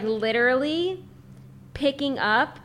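normal talking voice

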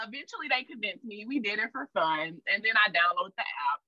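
normal talking voice

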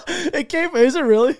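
high burst of laughter into a question; real laughter. Real, not acted. Host hearing it's only a gift certificate, laughing. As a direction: crack up and ask in disbelief. Cracks up at the reveal.

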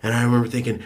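usual speaking voice

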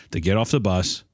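normal talking voice

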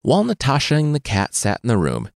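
plain reading voice

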